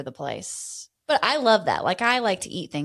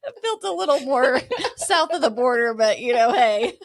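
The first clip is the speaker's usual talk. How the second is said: loud, higher, laughter breaking into the words; laughing while talking; 4 semitones higher, 6 dB louder than usual. Real, not acted. Co-host teasing her friend's botched accent, laughing. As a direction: tease through real laughter.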